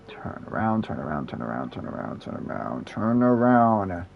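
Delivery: whisper. Real, not acted. Urging quietly in a game.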